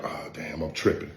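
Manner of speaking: breathy whisper to himself, caught out; whispering oh damn under his breath; whispered self-quote. Retold or imitated. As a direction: hush it like you just realized you're slipping. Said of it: "it made me be like".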